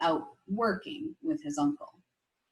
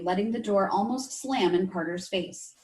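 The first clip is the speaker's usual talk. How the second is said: plain reading voice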